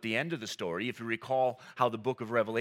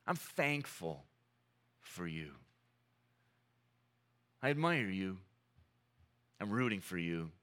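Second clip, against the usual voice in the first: gentle words; 6 semitones lower, 4 dB quieter than usual. Shown as a demonstration. Preacher modelling life-giving words to a loved one, soft and tender. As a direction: speak each line softly, like comforting someone you love. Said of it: "are gentle words".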